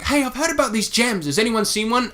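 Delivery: high-pitched imitation of a girl; mimicked girl asking around; girl's voice. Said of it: "this girl comes up and she's like".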